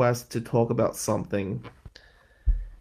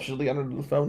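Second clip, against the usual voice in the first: ordinary talk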